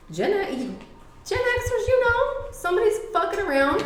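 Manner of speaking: high, emphatic warning; excited. Real, not acted. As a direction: pitch up and press the warning.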